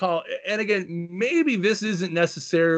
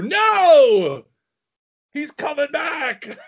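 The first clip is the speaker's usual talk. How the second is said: excited near-fall call, higher and louder; wrestling commentator; 12 semitones higher, 6 dB louder, much slower than usual. Acted. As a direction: yell the kickout like a ringside announcer. Mock calling a near fall.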